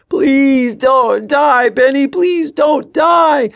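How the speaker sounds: high, loud, drawn-out desperate wail. A narrator's reading. Tommy begging Ben not to die, wailing. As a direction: wail the plea, stretching every word. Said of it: "Tommy had started wailing".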